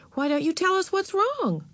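high-pitched, sympathetic aunt; interested and understanding. A narrator's reading. Aunt inviting her niece to confide, understanding. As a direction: coax gently with lifted pitch. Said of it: "sounded so interested and so understanding".

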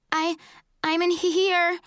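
high, tearful acted call; sobby. A narrator's reading. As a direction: call out through mock sobs. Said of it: "my voice all sobby".

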